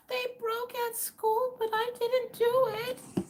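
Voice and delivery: put-on high, slow, tiny little-girl voice; little girl's innocent plea. Daughter telling the lady it wasn't her fault, high and innocent. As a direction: sound small and blameless. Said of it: "she's like".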